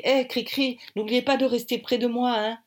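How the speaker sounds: high, anxious call; suddenly alarmed. A narrator's reading. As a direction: call out for her to stay close. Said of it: "soudain alarmé".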